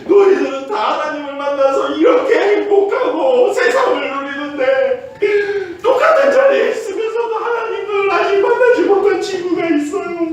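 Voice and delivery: high, loud revival-style exhortation; rally the campers at full voice; preaching shout. Retold or imitated. Youth pastor exhorts campers, shouting high and loud. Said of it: "다른 아이들에게 이렇게 얘기했죠".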